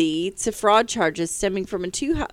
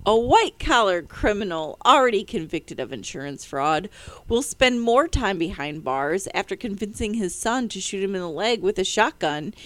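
ordinary talk, nothing marked

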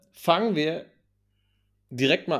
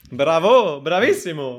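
mock Italian; 2 semitones higher, 6 dB louder than usual. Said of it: "falsche Sprache".

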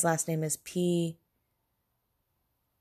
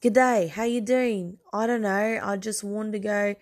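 badly faked Australian accent; attempted Australian accent; 2 semitones higher, 3 dB louder than usual. Shown as a demonstration. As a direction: try an Australian accent and miss. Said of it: "say you do an Australian accent".

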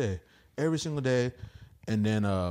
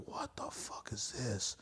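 breathless whispered disbelief; stunned whisper; 13 dB quieter, slower than usual. Retold or imitated. Comic at the show, whispering his shock. Retold stunned inner reaction.

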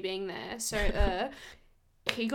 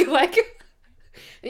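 laughing; 7 semitones higher, 11 dB louder than usual. Real, not acted. Laughing before boss quote.

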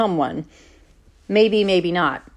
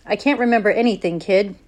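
usual speaking voice

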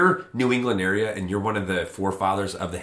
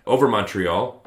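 louder, punchy sports news read; 5 dB louder than usual. Acted. News read over music.

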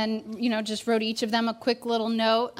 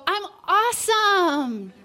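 much higher, chirpy put-on brightness; fake cheerful; 11 semitones higher, 5 dB louder than usual. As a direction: pretend everything is perfect. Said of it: "make up this fake story".

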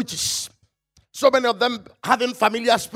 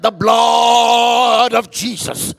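slow loud drawn-out cry; retold shout; 5 dB louder, much slower than usual. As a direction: shout it over the boy. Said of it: "and I shouted".

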